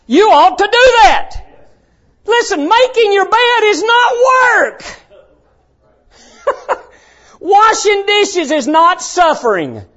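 high, emphatic pulpit shout; full-force preaching. Real, not acted. Preacher to kids about household chores, full-force preaching.

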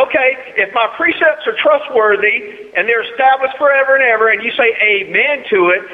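rolling pulpit cadence; preacher voice. Shown as a demonstration. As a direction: preach it out high and forceful. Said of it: "It's my preacher voice".

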